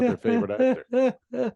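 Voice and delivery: a lisping fan squeaking out actor. Shown as a demonstration. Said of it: "someone with a lisp".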